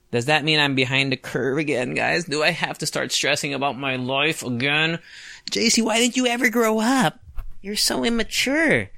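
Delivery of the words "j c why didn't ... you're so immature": high nagging scold voice; mock scold. Imagined critic scolding the host, high and nagging. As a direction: nag him like a disapproving aunt.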